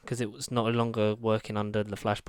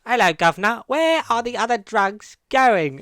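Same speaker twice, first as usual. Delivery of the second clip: imitating a Cockney Batman voice test; mock Cockney Batman; 9 semitones higher, 8 dB louder than usual. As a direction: ham up a cheery Cockney gangster. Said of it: "he does loads of different ones".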